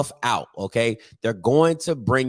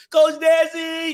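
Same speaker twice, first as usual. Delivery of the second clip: excited hype; slower than usual. Real, not acted. Hyping favorite coach.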